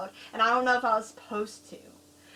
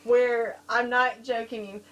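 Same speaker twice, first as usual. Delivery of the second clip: normal talking voice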